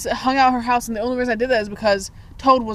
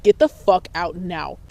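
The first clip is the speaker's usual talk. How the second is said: low, slow, deliberate order; cold anger; 4 semitones lower, slower than usual. Retold or imitated. Angry teen ordering an intruder out, low and menacing. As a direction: lower the pitch and space out the command. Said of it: "when I'm genuinely mad".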